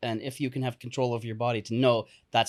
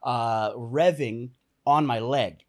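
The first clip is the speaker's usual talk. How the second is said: usual speaking voice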